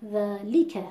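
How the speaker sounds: ذَلِکَ with heavy pressure on لام; ذَلِکَ with لام pushed too hard; overstressed lam. Shown as a demonstration. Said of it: "فشار بیارم روی حرف ل".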